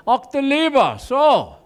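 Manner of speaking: lightly voiced stern German accent; mock humorless German cop accent; a hint of a German officer. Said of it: "It's like".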